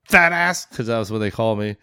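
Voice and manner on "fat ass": higher, louder barking sergeant major. Retold or imitated. Sergeant major insulting a departing soldier, barking loud. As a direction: bark it down at the recruit like a drill sergeant. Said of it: "or he goes".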